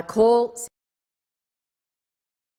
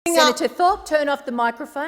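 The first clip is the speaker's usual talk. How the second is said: loud, high call over the chamber; shouting order order; 5 semitones higher, 4 dB louder than usual. Real, not acted. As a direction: shout her name to cut through. President shouts over protesting senator.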